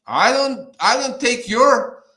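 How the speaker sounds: loud, insistent pushback; angry rant. Real, not acted. Man defending his money from interference, angry rant. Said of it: "It just makes me so angry".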